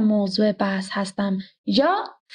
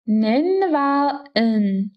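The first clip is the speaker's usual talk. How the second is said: slow demo; much slower than usual. Shown as a demonstration. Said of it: "slow repeat for learners".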